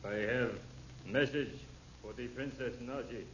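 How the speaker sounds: lightly foreign broken English; a stranger speaking careful broken English. Acted. Intruder at the window asking for the princess, foreign accent.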